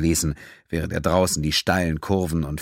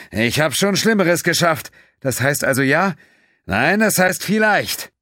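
higher, louder joking pilot under pressure; Quinn, cocky pilot; 10 semitones higher, 5 dB louder than usual. Acted. Quinn jokes to Pennington during the rough landing, cocky. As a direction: toss off the joke while landing.

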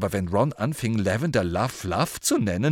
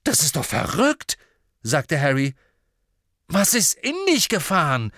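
higher, louder exasperation; exasperated shout; 7 semitones higher, 6 dB louder than usual. Acted. Harry shouting at Ron in exasperation. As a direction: shout back in disbelief.

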